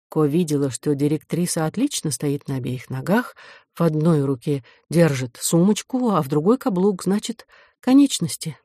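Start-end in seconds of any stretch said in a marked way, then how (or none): none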